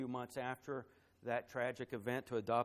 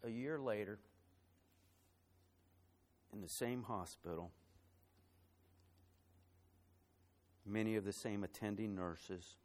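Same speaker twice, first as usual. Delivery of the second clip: softer, holding back tears as the story lands; fighting back emotion; 3 semitones lower, 5 dB quieter than usual. Real, not acted. Pastor tells the church of friends' lost baby, softly choked up. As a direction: keep it hushed, voice close to breaking. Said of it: "gonna try to tell this story without getting emotional".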